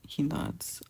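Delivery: plain reading voice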